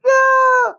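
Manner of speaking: loud, very high drawn-out wail; hammed-up heartbroken shout; mock anguished cry. Imitating her reaction to the sad dinosaur left behind, mock anguished scream. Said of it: "then he's looking sad, and she's".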